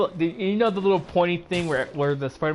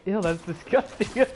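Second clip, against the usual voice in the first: mock disgust; 5 semitones higher than usual. Ned reacts to organic webs.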